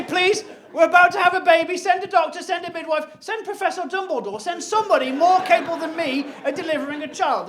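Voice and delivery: loud, high frantic begging; panicked plea. Retold or imitated. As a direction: beg down the phone in a panic. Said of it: "I say".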